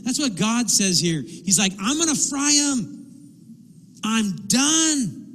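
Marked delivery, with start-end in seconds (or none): 1.8-5.2 high-pitched fed-up outburst; God at the end of patience, acted big; angry. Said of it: "He's angry".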